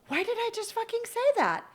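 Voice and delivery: high, fast, startled outburst; shocked at herself. Retold or imitated. Catching herself swearing, high startled disbelief. Shocked at her own words.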